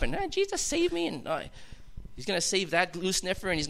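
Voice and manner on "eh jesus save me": much higher mock pleading cry; Albert's plea. Albert begging Jesus at the altar, high mock plea. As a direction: squeak it out like a desperate convert. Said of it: "Albert gets up".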